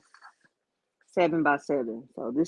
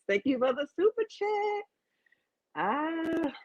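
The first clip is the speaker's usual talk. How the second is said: much higher, gushing thank-you; squealed thanks; 12 semitones higher, 5 dB quieter than usual. Real, not acted. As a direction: squeal with delight at the donation. Excited thanks for super chat.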